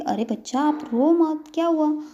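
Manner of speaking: put-on high, loud fussing over a child; concerned coaxing. Prerna to the crying girl, concerned coaxing. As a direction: coax a tearful child with lively concern.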